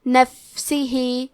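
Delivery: نفسہ میں فا کو ساکن رکھ کر آہستہ تلاوت; نفسہ کو ٹھہر ٹھہر کر، فا ساکن واضح; فا ساکن کے ساتھ. Shown as a demonstration. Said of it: "تو ہمیں یہ کلمہ کو ایسے پڑھنا ہے". (فا کو ساکن رکھتے ہوئے نفسہ کی آہستہ تلاوت)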